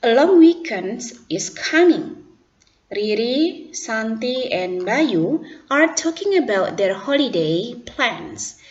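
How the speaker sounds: slow, careful narration read; read-aloud demo. Shown as a demonstration. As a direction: read it out clearly for the class. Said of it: "sekarang didengarkan cara bacanya".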